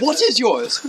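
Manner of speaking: usual speaking voice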